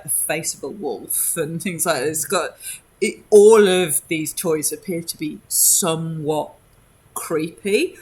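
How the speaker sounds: ordinary talk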